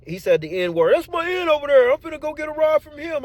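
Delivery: squeaky grateful coworker; coworker's high voice. Retold or imitated.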